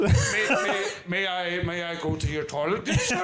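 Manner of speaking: laughing, hammed-up polite request; overly formal, stoned and cracking up; mock posh politeness. Stoned guest asking host for toilet, hammed-up posh request. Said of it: "asking for permission".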